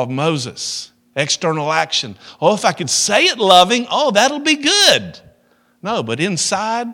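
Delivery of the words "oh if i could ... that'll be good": high, loud, hammed-up enthusiasm; mock delight. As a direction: gush with put-on relief, stretching the end. Mocks his own outward fix.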